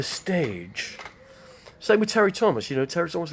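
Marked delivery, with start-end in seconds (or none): none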